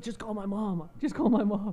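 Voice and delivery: hammed-up plea of an injured kid; mock pleading. Retold plea, repeated more urgently.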